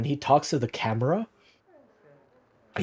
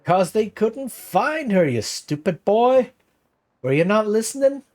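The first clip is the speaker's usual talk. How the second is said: put-on cranky old man berating the boy, higher, slowed; mock scolding; 9 semitones higher, 4 dB louder than usual.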